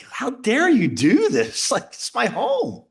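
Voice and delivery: outraged at the intrusion on his home; indignant. Retold or imitated. Homeowner, to agents at his door, indignant.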